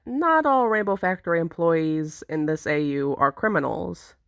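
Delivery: ordinary delivery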